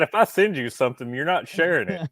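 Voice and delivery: play-scold a buddy who leaked it; mock scolding. Acts out the sender scolding his friend.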